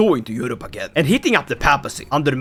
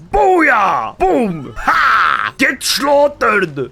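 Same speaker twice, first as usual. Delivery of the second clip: gloating war cry; 3 semitones higher, 3 dB louder, much slower than usual. Acted. Gloating after a won battle.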